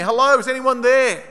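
high, loud retold call. Survivor hears walkie-talkies and tries rescuers, calling out. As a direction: call out into the distance for an answer. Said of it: "I'd call out".

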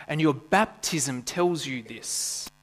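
ordinary talk, nothing marked

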